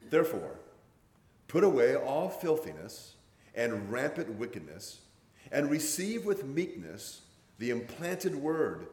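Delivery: slow, emphatic Bible reading from the pulpit; scripture reading aloud. Real, not acted. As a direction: read each phrase deliberately, with weighty pauses.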